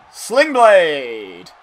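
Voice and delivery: loud, drawn-out shout of the move; excited move call. Real, not acted. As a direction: call it out like a ringside commentator. Calling out a wrestling move.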